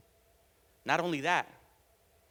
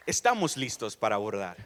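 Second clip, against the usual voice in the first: Spanish pilot announcement; 6 semitones lower, 4 dB louder than usual. Retold or imitated. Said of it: "sounded like a Spanish pilot".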